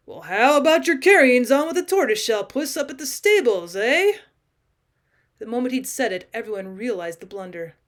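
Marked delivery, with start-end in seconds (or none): 0.1-4.2 louder, higher blustering jibe; heavy blundering. A narrator's reading. Major diverting attention by teasing the cat, loud and blustering. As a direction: barge in loudly to change the subject. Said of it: "Major Barfield plunged in heavily".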